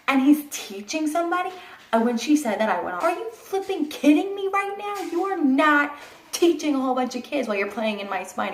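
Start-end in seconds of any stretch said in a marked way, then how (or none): 3.0-8.5 higher, indignant burst at the doctor; furious disbelief, pitched way up; retold outrage. Yelling at doctor mid-epidural.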